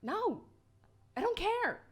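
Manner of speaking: high, curt brush-off; huffy dismissal. Acted. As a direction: toss it off irritably. Character snaps back.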